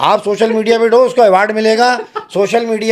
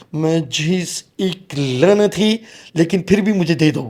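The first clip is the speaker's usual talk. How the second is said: slow, drawn-out comic father-in-law voice; Munne ke Abba; 3 semitones lower, 4 dB quieter, slower than usual. Acted. Comedian showing the groom how to ask in-laws for dowry, comic character voice. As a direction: put on a comic character voice, stretching the opening words. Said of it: "منع کے ابا کی آواز نکالیے گا".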